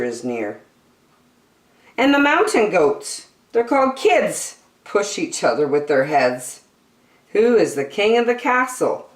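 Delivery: bright, lilting picture-book read-aloud; reading to children. Acted. Reading an animal picture book to young children, bright and lilting. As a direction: read it like a bedtime nature book for kids.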